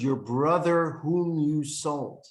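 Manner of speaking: "your brother whom you sold" slow, each word weighed; stress on your brother. Shown as a demonstration. Joseph proving he still feels like a brother, stressing the words. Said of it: "he stressed the words".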